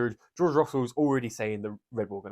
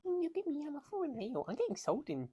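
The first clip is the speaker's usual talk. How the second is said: whispered car voice; 9 dB quieter than usual. Pretend. Voicing the car itself.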